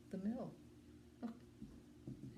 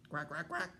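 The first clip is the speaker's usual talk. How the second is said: quick run of quacks; duck quacking. A narrator's reading. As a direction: quack like a duck, rising at the end. Imitating duck in read-aloud story.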